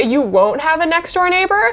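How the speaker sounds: ordinary talk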